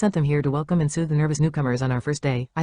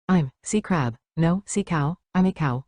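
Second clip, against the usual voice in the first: ordinary delivery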